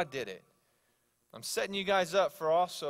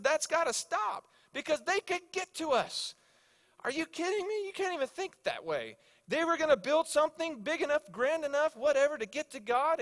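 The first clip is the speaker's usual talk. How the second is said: very high, astonished excitement; pumped; 10 semitones higher, 4 dB louder than usual. Retold or imitated. Coworker retelling God stopping Babel, pumped. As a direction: play it incredulous and worked up, voice way up. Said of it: "He was so pumped".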